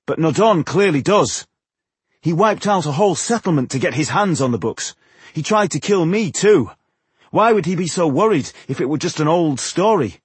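high, forceful rebuttal; cutting in. A narrator's reading. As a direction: argue back with heated conviction. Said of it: "Tungdil cut in".

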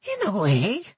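put-on high, nasal old goblin woman; thick nasal goblin voice. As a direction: pinch it through the nose, goblin. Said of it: "in a thick nasal voice".

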